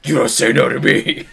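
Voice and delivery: loud, hammed-up demand; mock indignant outburst. Friend teased about not taking no, mock-angry retort. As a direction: play at refusing to be refused, loudly. Jokes he won't take no.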